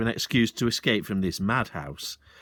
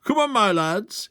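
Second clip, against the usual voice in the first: higher, louder rallying call; cried out; 10 semitones higher, 5 dB louder than usual. Acted. Sergeant rallying father and son, a loud cry. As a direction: rouse the lads to action. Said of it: "he cried".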